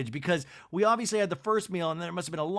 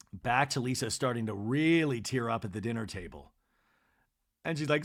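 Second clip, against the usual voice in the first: usual speaking voice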